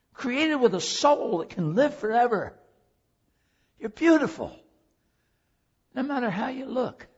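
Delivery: ordinary talk, nothing marked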